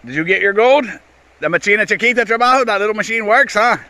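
high, loud call across the river. Real, not acted. Calling to the worker across the dredge, high and loud. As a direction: shout over to the worker about his gold.

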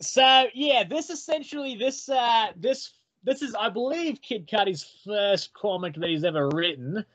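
a light put-on Australian accent; lightly done Australian accent. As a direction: say it like a casual Aussie mate. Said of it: "keep this accent up".

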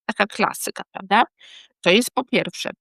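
ordinary talk, nothing marked

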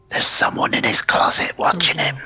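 hushed, ominous whisper; spooky reveal whisper. A narrator's reading. Horror reveal.

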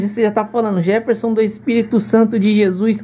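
high, delighted and amused; light up hearing your full name; excited. Real, not acted. Excited reaction to his full name.

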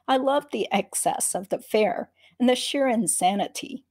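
ordinary delivery, nothing marked

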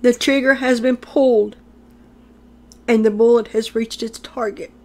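solemn proclaiming prophet, measured and grave; prophetic preaching. Acted. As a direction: pronounce doom like an oracle. Prophetic address to America.

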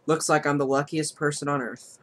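normal talking voice

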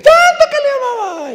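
high, cracking wail of a grieving son. Retold or imitated. Son wailing at his mother's burial, high sobbing wail. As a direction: cry out in mock grief at the grave. Son's theatrical wailing retold.

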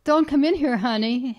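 firm call, easing on the endearment. A narrator's reading. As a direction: call to your child in another room. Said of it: "Harriet called out".

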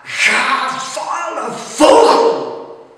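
high, loud, slow cry of rage; furious shout. Acted. The poet driving the raven out, furious slow shout. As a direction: hurl it at the bird with all your voice. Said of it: "riep ik toen".

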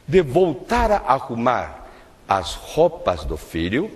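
normal talking voice

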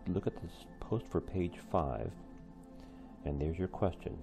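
ordinary delivery

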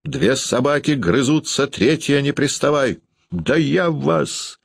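high-pitched furious warning; threaten, stretching the final words; angry shout. A narrator's reading. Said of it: "и закричал".

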